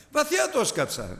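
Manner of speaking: put-on high, sheepish little-boy voice; boy lying to his father, high and timid; child's fib.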